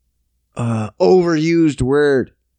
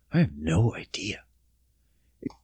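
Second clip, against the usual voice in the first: breathy whispered resignation; unvoiced defeated whisper; 8 semitones lower, 9 dB quieter than usual. Real, not acted. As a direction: whisper it to yourself. Drops to whisper.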